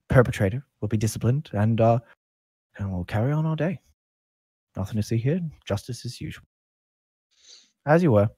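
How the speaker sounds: lightly voiced officer closing the case; guard captain. Captain telling onlookers it is over, lightly official. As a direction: send the crowd on its way. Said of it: "He's like".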